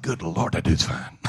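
low, appreciative aside under the breath; awed aside. Retold or imitated. Preacher recalls admiring a handsome passenger, awed aside. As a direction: murmur it low in awe.

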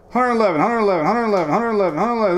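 rapid auctioneer chant. Acted. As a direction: rattle it off like an auctioneer. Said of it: "This is like an auctioneer".

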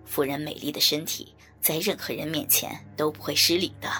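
low, mocking villain voice; deep-voiced sly mockery; teasing. A narrator's reading. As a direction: taunt with false politeness, pitched deep. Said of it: "一边调笑道".

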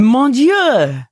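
put-on French accent. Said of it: "Well, he goes".

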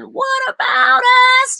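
soaring falsetto pop wail. Retold or imitated.

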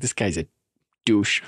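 lightly put-on Boston local; mock Boston accent. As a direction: imitate a Bostonian insulting someone. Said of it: "Everybody from Boston's like".

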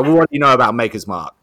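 loud, rapid-fire challenge; grilling. Retold or imitated. Rep quizzing the new bar manager, grilling hard. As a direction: imitate an interrogating rep, fast and forceful. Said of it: "she's grilling me like this".

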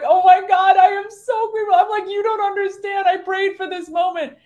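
retold emotional outburst, high-pitched and starting loud; overwhelmed, tearful gratitude. Retelling her thoughts after praying for a friend, tearfully overwhelmed. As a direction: pour out gratitude as if about to cry. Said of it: "I started to cry".

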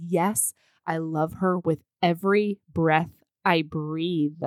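ordinary talk, nothing marked

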